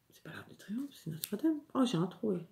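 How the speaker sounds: unvoiced whisper to herself; whispering an aside while correcting herself; whispered correction. Real, not acted. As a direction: catch the mistake in a hushed whisper. Correcting herself under her breath.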